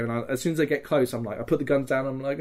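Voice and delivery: ordinary talk, nothing marked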